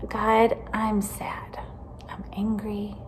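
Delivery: slow hushed whisper; whispered prayer. Shown as a demonstration. Teacher showing kids how to tell God feelings, whispered.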